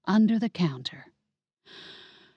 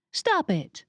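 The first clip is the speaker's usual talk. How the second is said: sharp protest; 9 semitones higher than usual. Acted. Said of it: "I said".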